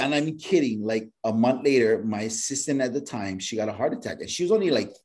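ordinary talk, nothing marked

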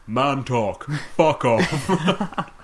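mock Bond. Host quoting Bond sending the woman away, mock voice. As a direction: ham up a brusque Bond. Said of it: "slaps Dink on the arse and says".

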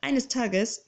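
plain reading voice

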